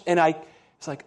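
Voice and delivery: ordinary talk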